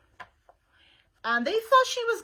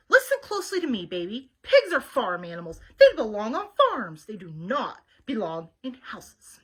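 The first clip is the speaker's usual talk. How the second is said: put-on a stern older sister laying down the rules, louder on the start; bossy older sister; 6 dB louder than usual. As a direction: lecture her like a know-it-all big sister. Eugenia lectures her younger sister.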